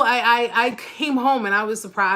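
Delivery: ordinary delivery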